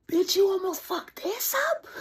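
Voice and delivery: high loud squeal; high, loud, giddy shriek; mock yell. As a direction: shriek at yourself in giddy relief. Mock self-scolding in relief.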